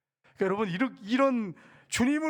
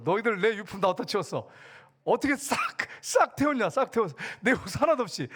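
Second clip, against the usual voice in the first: mock anger; 3 semitones higher than usual. Revived Lazarus telling off his sisters over his belongings, mock anger. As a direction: play an outraged scolding, climbing in pitch. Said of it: "막 화를 내면서".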